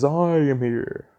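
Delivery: booming arrival of the top hero; All Might. Acted. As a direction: announce your arrival like the big hero. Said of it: "All Might comes and says".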